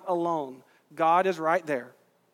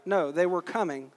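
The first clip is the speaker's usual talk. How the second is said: ordinary delivery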